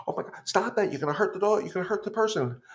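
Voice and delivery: high-pitched mock scolding of the dog; mock frantic scolding. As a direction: play the panicked, annoyed owner, pitched high. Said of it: "you get mad at your dog".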